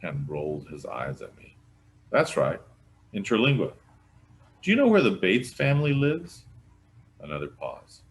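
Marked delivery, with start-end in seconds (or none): 2.1-6.5 raised, carrying phone voice; sheriff on the phone. Acted. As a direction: talk up into the phone.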